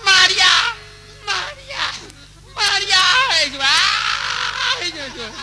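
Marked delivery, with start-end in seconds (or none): 0.0-3.0 calling out. Acted. Calling out to María. 3.4-4.9 drawn out cry. Acted.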